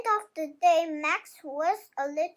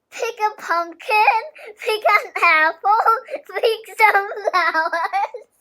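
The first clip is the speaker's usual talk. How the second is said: louder and louder list of chores, laughing at the end; growing pile of requests; 3 semitones higher, 9 dB louder than usual. A narrator's reading. Aunts' endless list of requests.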